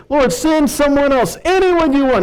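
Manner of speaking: high-pitched begging plea; reluctant prophet begging off; pleading Moshe. Acted. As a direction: whine it up high to pass the job on.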